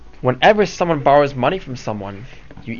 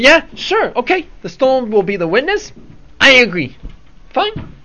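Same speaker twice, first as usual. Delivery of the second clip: overeager agreement; 9 semitones higher than usual. Acted. Rashid slyly agrees to the deal.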